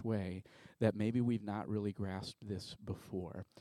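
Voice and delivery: soft, slow opening prayer. Acted. Pastor quietly opening the sermon in prayer to the Father. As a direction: pray quietly over the congregation.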